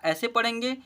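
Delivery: ordinary delivery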